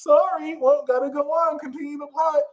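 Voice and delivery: mock dismissive partner. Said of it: "all they can be like is".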